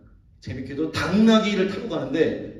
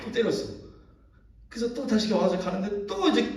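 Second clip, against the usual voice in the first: usual speaking voice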